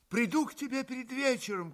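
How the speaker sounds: called out. A narrator's reading. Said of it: "крикнул Алёша".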